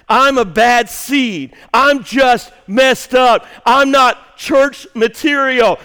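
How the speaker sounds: slow, loud, despairing inner voice; voicing self-doubt. Pastor voicing a churchgoer's doubts, slow anguished shout. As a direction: cry out the words of someone who feels unworthy.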